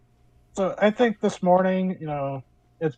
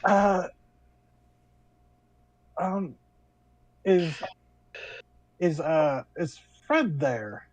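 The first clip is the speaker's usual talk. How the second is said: younger feminine voice; 2 semitones higher, slower than usual. Acted. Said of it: "younger voice, feminine".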